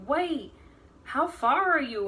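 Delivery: high, loud, slow shocked question; mother-in-law demanding how far along, stunned; stunned disbelief. Retold or imitated. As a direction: react like the news just floored you. His mom stunned by pregnancy.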